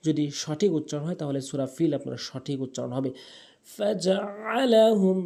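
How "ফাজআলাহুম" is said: higher, slow "ফাজআলাহুম" with throat ain. Shown as a demonstration. Teacher modelling for learners, "ফাজআলাহুম" with pharyngeal ain. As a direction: chant "ফাজআলাহুম", pressing the ain. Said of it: "ফাজআলাহুম বলতে হবে".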